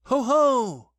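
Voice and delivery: sudden high, loud call; startling, booming hail. A narrator's reading. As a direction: burst out loudly. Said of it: "said Christopher Robin loudly and suddenly".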